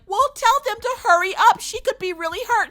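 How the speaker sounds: high mock-hysterical urging; frantic dog owner. Owner urges receptionist to hurry the vet, mock panicked. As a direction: start loud, hammed-up panic.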